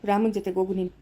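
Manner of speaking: normal talking voice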